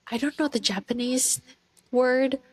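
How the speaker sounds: normal talking voice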